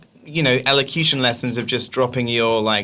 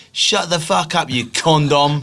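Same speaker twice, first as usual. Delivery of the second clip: mock insult, pitched up and drawn out; demonstrated insult; 4 semitones higher, slower than usual. Brit showing any noun works as an insult, mock abuse. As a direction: slowly hurl a pretend insult. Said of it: "it would be like saying to".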